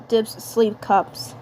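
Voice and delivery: plain reading voice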